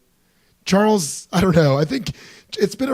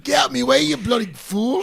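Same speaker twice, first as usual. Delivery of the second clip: high British villain voice; Niles voice; 8 semitones higher than usual. Retold or imitated. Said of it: "I feel like Niles".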